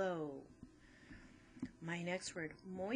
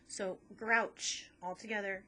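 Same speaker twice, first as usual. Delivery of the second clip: slow, louder grouch all together; slow syllable split; 2 semitones higher, 5 dB louder than usual. Shown as a demonstration. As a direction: draw out grouch. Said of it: "where I'm gonna cut my syllables".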